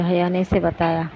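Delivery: plain reading voice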